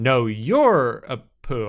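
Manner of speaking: slow "No, you're a poo" with each word separate; stress on "No, you're a poo". Shown as a demonstration. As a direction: draw out "No, you're a poo" so the pun lands. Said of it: "put the emphasis on".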